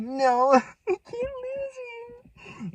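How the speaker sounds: high, drawn-out mock wail breaking into laughter; mock crying. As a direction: imitate a sobbing boyfriend, hammed-up. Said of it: "and he's crying".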